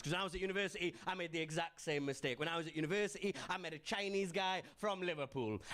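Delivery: ordinary talk, nothing marked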